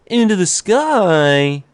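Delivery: slow, high conspiracy voice; drawn-out ancient aliens host; ancient aliens guy. Pretend.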